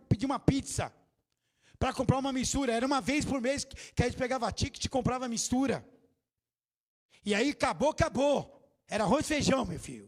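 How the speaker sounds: ordinary delivery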